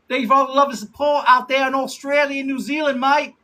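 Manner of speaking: high, put-on Aussie thank-you; Australian accent. Streamer thanking viewers down under in a put-on Australian accent. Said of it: "I literally try to practice my Australian accent".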